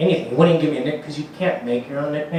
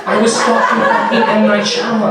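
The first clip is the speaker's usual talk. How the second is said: higher, louder, laughing amazement; amazed disbelief; 6 semitones higher, 6 dB louder than usual. Real, not acted. Comic realizing he stalked a famous director, laughing disbelief. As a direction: marvel at it through a laugh.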